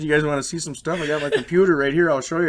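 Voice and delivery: eager retold offer. Man urging the police to look at his computer, eager and loud.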